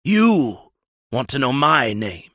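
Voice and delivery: possessed man mocking the captain; demonic taunt. A narrator's reading. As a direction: drag out the menace.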